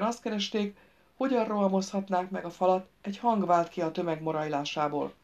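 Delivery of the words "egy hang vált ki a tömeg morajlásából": plain reading voice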